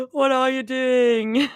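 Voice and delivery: playful slow-motion question; drawn-out stim. Acted. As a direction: stretch it out in a silly voice. Repeats line in two ways.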